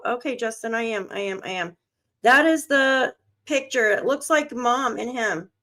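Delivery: ordinary talk, nothing marked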